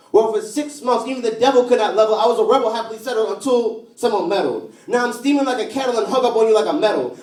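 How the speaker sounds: impassioned slam poetry. Acted. Poet steaming over the love that was taken away, impassioned. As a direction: build a heated, rhyming outpouring. Poem builds to heated outburst.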